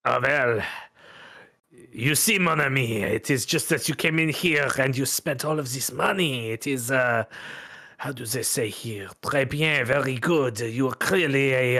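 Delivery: suave French gentleman, unhurried; a light French accent, charming and smooth. Acted. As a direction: purr it out like a smooth French card player. GM voices suave French fellow.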